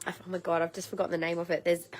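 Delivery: normal talking voice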